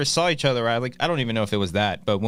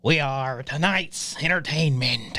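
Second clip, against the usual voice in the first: slow, drawn-out theatrical villain greeting; Joker; 2 semitones lower, slower than usual. Acted. Host opens the show with a Joker line, slow impression. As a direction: stretch every word like a menacing showman. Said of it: "let me hear your Joker impression".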